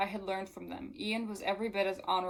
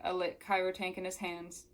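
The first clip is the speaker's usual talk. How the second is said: ordinary delivery, nothing marked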